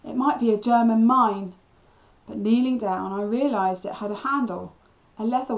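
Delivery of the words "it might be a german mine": loud, urgent caution; alarmed, louder call; cried warning. A narrator's reading. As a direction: warn with raised voice. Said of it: "Cliff cried".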